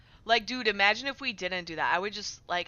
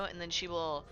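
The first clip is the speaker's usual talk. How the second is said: ordinary talk, nothing marked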